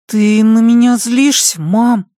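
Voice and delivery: put-on high, timid child's question; a small boy asking his mom; young boy voice. A narrator's reading. (робкий мальчик, высокий голос)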